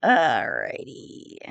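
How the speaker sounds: very low, slow, soft "Alrighty"; drawn-out reset. Real, not acted. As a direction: stretch it out way down low. Resetting after the tangent.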